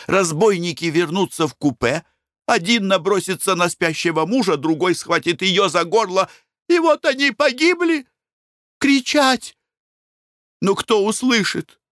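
spiral into panic; rising panic. A narrator's reading. Imagined robbery, rising panic.

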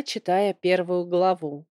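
usual speaking voice

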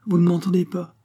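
high, haughty challenge; hauteur royale. A narrator's reading. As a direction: demand it like an offended queen. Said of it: "interrompit la reine avec hauteur". (d'un ton hautain)

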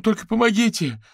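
high, loud panicked begging; wailing plea. Acted. Fetisov begs Averin for help over the phone, wailing. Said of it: "Да что ж вы так причитаете-то".

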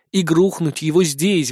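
ordinary delivery, nothing marked

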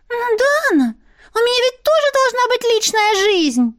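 high, pleading creature voice; whiny otter voice. Acted. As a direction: whine it like a spoiled pet. Otter whines.

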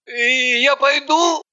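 slow pleading whine; begging to go home. A narrator's reading. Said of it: "запросился домой Пупс".

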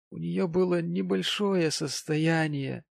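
high, slow sentimental gentleman; maudlin tipsy gentleman. Acted. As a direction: draw it out in tipsy wistfulness. Voices maudlin Mr Pecksniff.

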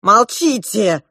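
loud, slow angry command; seriously angry. A narrator's reading. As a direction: order them silent. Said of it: "не на шутку рассердился принц". (сердитый приказ)

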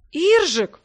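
high, loud startled exclamation; stunned outburst, pitch leaping up; dumbfounded. A narrator's reading. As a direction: blurt the name in shock. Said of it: "ошарашенно произнёс второй голос".

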